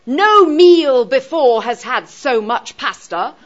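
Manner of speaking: loud, slow weight on "No meal"; stress on No meal. Shown as a demonstration. Teacher showing the class an awkward reversed sentence, stress on "No meal". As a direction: declaim it, hitting "No meal" hard up front. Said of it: "putting the negative emphasis right".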